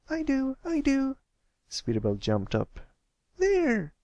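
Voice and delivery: a filly spotting the lion; excited filly. Acted. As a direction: bounce with excitement. Sweetie Belle jumps up excited.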